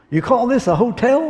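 a brash rancher scoffing; a rich Texan sneering at the hotel. Retold or imitated. Texan mocking hotel in joke.

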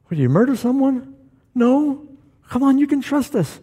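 high, pressing, half-joking disbelief; mock shocked friends; incredulous prodding. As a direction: push him to spill it, voice way up. Friends pressing third pastor.

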